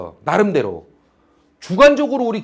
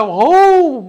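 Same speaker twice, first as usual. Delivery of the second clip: mock outcry; 7 semitones higher, 8 dB louder, much slower than usual. Imitating a bystander's outcry.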